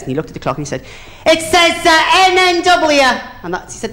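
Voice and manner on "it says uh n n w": very high, drawn-out mock wail; hammed-up frantic distress, stretched out; comic panic. Novice flyer reporting the dead pilot, comic panic.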